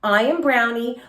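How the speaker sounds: usual speaking voice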